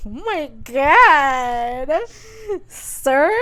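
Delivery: loud, very drawn-out aghast reaction; exaggerated dismay. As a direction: stretch it out, theatrically appalled. Teller reacting aghast to book.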